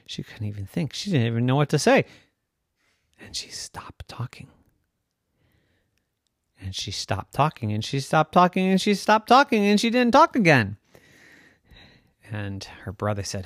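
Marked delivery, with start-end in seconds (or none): 3.2-4.5 very soft storytelling whisper; hushed whisper. A narrator's reading. Narrator telling how Yvette lost her voice, hushed whisper. 6.6-10.8 building repetition. A narrator's reading. Narrator insisting that Yvette never talked, building repetition. Repeated line climbs in pitch.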